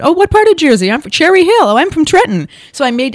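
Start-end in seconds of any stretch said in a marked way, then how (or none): 0.0-0.9 high, loud, delighted question; thrilled to find a fellow Jersey native; excited lady. Retold or imitated. Lady behind her in line, thrilled to meet a Jersey neighbor, high and loud. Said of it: "the lady behind me said". 0.9-2.5 high, loud, eager back-and-forth; swapping hometowns with delight; excited chatter. Retold or imitated. Strangers in line excitedly swapping Jersey hometowns, high and loud. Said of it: "the lady behind me said".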